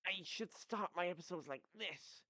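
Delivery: high anime-style impression. Shown as a demonstration. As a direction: pitch it up like an anime character. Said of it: "like, an anime voice".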